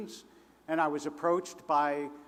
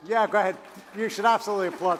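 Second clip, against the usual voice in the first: loud and higher, calling out over the crowd; calling out to the cheering audience, loud and high; shouting over applause; 4 semitones higher, 6 dB louder, much faster than usual. Real, not acted. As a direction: raise your voice over the clapping.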